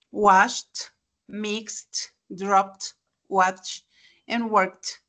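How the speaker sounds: Washed, mixed, dropped, watched, worked with -ed as T; T endings. Shown as a demonstration. Teacher recaps Washed, mixed, dropped, watched, worked, T endings. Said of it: "se convierten en T".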